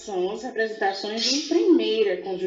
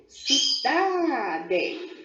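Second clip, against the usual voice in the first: CIDADE in three beats with a long, raised DA; syllable by syllable; 4 semitones higher than usual. Shown as a demonstration. Teacher showing three syllables, CIDADE split and drawn out. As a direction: sound out CI-DA-DE, stretching the middle. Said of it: "a emissão de vozes três vezes".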